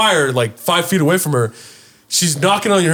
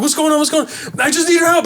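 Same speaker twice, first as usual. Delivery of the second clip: alarmed; 13 semitones higher than usual. Retold or imitated. Frantic exchange with mom.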